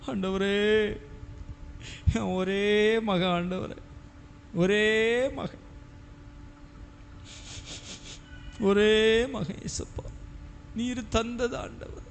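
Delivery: slow, drawn-out mock weeping prayer; tearful pleading. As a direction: act out crying to God, stretching the words. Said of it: "ஏன் அழுற?".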